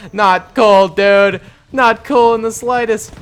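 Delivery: high, loud playful complaint; mock protest. Streamer protests for the bombed Brits, high mock complaint. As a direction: protest loudly, half in jest.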